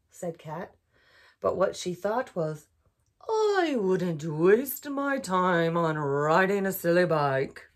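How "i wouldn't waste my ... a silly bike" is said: slow, bored drawl; can't be bothered; lazy cat. A narrator's reading. Cat unimpressed by the duck, slow and bored. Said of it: "Cats are known to be kinda lazy".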